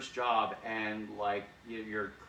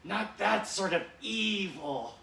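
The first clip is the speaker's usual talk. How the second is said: Cobra Commander; 9 semitones higher, 4 dB louder than usual. Retold or imitated. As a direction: scold like a pompous villain. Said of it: "And Cobra Commander".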